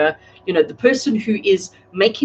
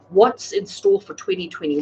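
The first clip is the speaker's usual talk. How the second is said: ordinary talk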